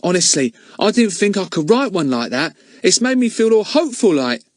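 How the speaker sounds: put-on high boyish voice; proud and hopeful lad; Ern hopeful. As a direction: Ern tells Betts the poem made him hopeful. Ern's voice in the story.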